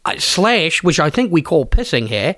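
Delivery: broad mock Aussie; hammed-up Aussie bloke; Australian accent. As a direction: lay on a thick Australian accent. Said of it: "do letters in Australian".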